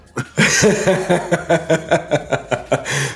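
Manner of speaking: hearty burst of laughter; loud laugh. Real, not acted. Guest laughs at own story.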